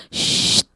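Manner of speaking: sharp "shh"; angry shushing. Acted. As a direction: shush a noisy man. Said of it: "ada yang marah-marah dan bilang". (desis menyuruh diam)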